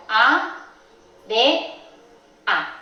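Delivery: "A, D A" as three isolated syllables, á, de, a; sílabas separadas. Shown as a demonstration. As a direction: spell out área syllable by syllable with long pauses. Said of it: "se separarían en dos sílabas diferentes". ("A, D A" sílaba por sílaba, con pausas)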